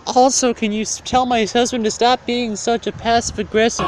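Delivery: high falsetto imitation of a wife; hammed-up swooning wife in falsetto; flirty wife. Voices the wife flirting with friar.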